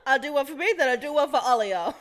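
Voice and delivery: loud, smug mock dog voice; cheeky dog voice while laughing; voicing the dog. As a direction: speak as the proud pooping dog. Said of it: "He's like".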